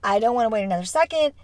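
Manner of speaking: usual speaking voice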